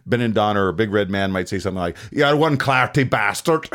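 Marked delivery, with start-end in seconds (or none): 2.1-3.7 raised, slow Scottish taunt; big Scottish giant jeering, louder and higher; Scottish insult. Acted. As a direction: hurl it in a Scottish accent. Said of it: "like Scottish insults and Irish".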